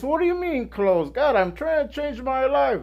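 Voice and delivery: high-pitched, exasperated protest; indignant complaint. Retold or imitated. Complaining to God that the church is closed, indignant.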